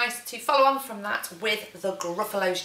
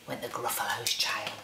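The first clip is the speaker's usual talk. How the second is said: hushed whisper; 9 semitones lower, 6 dB quieter than usual. A narrator's reading. Reader to children at storytime, whispered. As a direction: breathe it like a secret.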